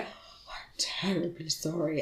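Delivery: slow, soft receptionist whisper; hushed apology. Retold or imitated. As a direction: whisper a mock-grave apology. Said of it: "And she was like".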